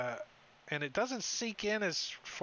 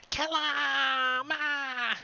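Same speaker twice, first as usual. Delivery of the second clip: high, drawn-out charging yell; mock war cry of a little goblin; goblin battle cry; 10 semitones higher, 5 dB louder than usual. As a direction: shriek it like an attacking goblin.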